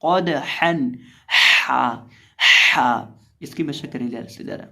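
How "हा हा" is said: हा pushed hard from the middle of the throat; a loud breathy हा from deep in the throat; throaty ha. Shown as a demonstration. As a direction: say हा from the throat, forcefully. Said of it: "हा को हलक के दरमियान से अदा करेंगे".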